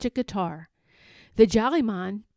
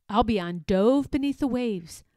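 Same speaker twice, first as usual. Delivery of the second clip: ordinary delivery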